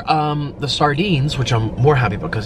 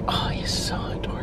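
soft breathless whisper of adoration; 9 dB quieter than usual. Retold or imitated. Watching boyfriend greet his plants, whispered. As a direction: whisper it to yourself, charmed. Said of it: "And I was just like".

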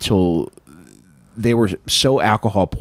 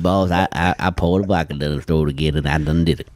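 put-on Canadian accent; 3 semitones lower than usual. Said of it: "Is that what you think a Canadian accent is?".

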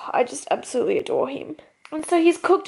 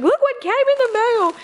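high, gleeful mock announcement; acted excitement, pitched up; imitating excited partner; 9 semitones higher, 3 dB louder than usual. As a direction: playfully mimic someone thrilled by a parcel. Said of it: "he's so excited as well".

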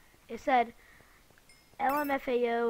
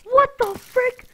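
loud, very high, slow outrage; outraged shout; 11 semitones higher, 15 dB louder, slower than usual. Real, not acted. Gamer yelling in disbelief at losing his stuff, loud and high. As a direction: yell it out in disbelief.

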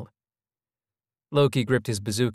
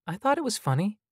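high-voiced, apologetic woman; 12 semitones higher than usual. A narrator's reading. Sunita excusing her laughter to Loki, apologetic. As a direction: say it as a small apology, pitched up. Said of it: "Sunita sounded apologetic".

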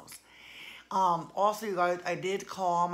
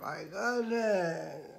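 weary sigh; much slower than usual. Real, not acted. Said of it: "I feel like I'm falling apart".

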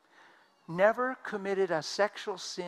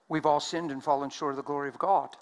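ordinary talk, nothing marked

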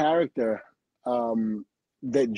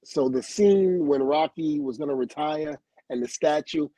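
ordinary talk, nothing marked

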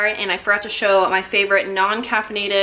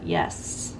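ordinary talk, nothing marked

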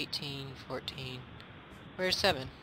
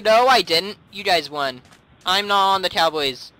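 usual speaking voice

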